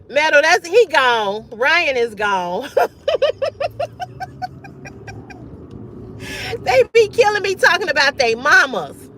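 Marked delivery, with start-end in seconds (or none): 2.7-6.5 long squealing laugh, pitched way up; long high laugh. Real, not acted. Streamer laughing after removing a guest, high squeal.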